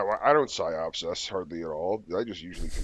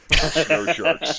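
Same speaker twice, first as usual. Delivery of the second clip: excited; 8 semitones higher, 11 dB louder than usual. Real, not acted. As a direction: punch out "Snow sharks" loud and high. Excited punchline about snow sharks.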